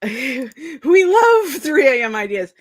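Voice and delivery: slow, laughing through the words; tired giggly delight. Real, not acted. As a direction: giggle it out slowly, loud and high. Laughing at her own 3am idea.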